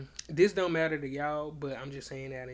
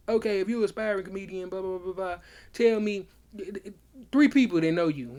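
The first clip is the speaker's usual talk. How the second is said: louder, higher retort from a doubting friend; imitated challenge; 5 semitones higher, 9 dB louder than usual. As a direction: act out a friend throwing down a challenge. Friend challenging him in an acted argument.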